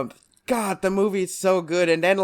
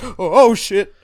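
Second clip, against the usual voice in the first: louder, higher scared gasp of a curse; mock fear; 3 semitones higher, 8 dB louder than usual. Batman on the roof afraid of the height, alarmed. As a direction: act startled by a huge drop. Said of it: "he's afraid of this height".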